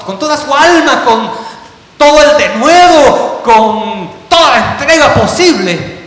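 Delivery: loud, building preacher's cry; climbing to a shouted peak of devotion; full-force preaching. Acted. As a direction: preach it out with rising fervor.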